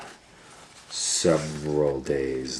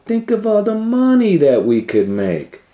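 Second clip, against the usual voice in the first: much higher, louder greedy squeal; greedy villain; 7 dB louder than usual. Acted. Doctor Varmint dreaming of profit, high greedy voice. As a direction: gloat over the money.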